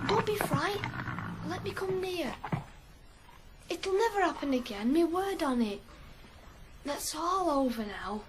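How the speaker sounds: soft, gentle coaxing to calm the horses; soothing the horses. Acted. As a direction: win back the frightened horses softly.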